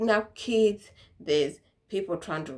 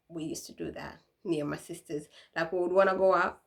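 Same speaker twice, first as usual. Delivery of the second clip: usual speaking voice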